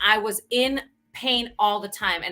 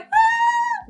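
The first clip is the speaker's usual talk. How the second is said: mock falling scream; 6 dB louder than usual. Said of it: "I wasn't even screaming".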